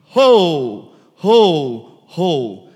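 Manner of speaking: loud calling cry, fading on the last; loud herding call; shepherd's call. Retold or imitated. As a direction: call out to the flock while walking. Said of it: "starts crying out loud".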